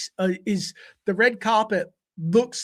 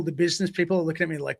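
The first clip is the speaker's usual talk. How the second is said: normal talking voice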